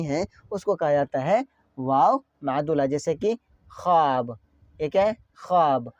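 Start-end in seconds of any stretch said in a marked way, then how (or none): 3.7-4.4 ख्वाब said as khaab, no w; silent waw. Shown as a demonstration. Teacher giving learners an example, waw left silent. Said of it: "वाव का यहाँ पे pronunciation नहीं हो रहा". 5.4-5.9 ख्वाब as khaab, pitch falling; silent waw. Shown as a demonstration. Teacher repeating the example for learners, waw left silent. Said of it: "वाव का जो है यहाँ पे आवाज़ नहीं".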